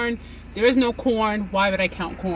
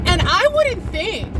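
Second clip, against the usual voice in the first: usual speaking voice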